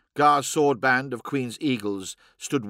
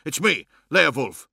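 louder, insistent self-introduction; snapped retort; 5 dB louder than usual. A narrator's reading. Man reminding an acquaintance who he is, snapped. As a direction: push the words out with mild impatience. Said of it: "snapped the man".

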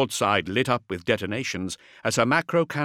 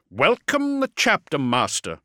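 unwelcoming; 7 semitones higher, 4 dB louder than usual. A narrator's reading. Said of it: "in a voice that offered little of the kind".

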